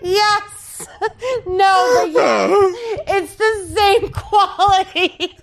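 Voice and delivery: high, teary laugh-crying through the words. Real, not acted. Host laughing and crying, moved by her own story. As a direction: laugh helplessly while near tears.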